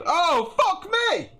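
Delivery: high, slow imitated shriek; startled shriek. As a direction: shriek it like you just saw a spider. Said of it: "why the lady just said".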